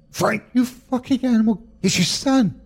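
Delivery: high, furious cry at her husband; furious mother. Acted. Mother shielding her son, cries out in fury. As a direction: scream him off the boy.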